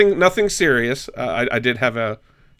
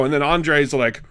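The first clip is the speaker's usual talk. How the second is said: normal talking voice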